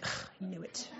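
very soft, groaned admission; hushed mock exasperation; retold grudging admission. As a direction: grumble it under your breath, acting out defeat. Retold reaction to being told off.

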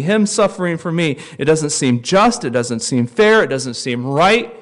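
fervent pulpit cry; impassioned preaching. Acted. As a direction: hammer each phrase home. Impassioned preaching at full force.

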